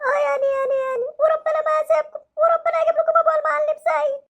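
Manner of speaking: put-on hurt boy threatening the doctor with his dad; sulky hurt child.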